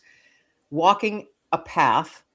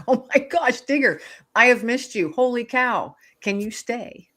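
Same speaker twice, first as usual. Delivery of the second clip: higher-pitched, emotional reunion outburst; retold tearful joy; 7 semitones higher than usual. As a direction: relive an overwhelmed, pleading greeting, without real sobbing. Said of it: "I'm crying".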